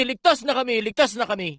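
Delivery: ordinary talk, nothing marked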